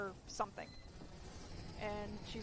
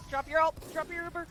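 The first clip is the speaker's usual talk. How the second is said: loud urgent command; shouted order; 5 semitones higher, 6 dB louder than usual. Real, not acted. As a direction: yell at the team to act now. Ordering teammates in game.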